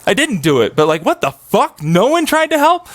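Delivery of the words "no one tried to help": high, stunned disbelief; incredulous. Real, not acted. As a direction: pitch jumps high in disbelief. Incredulous outburst.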